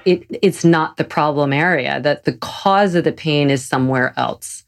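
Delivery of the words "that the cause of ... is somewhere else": ordinary talk, nothing marked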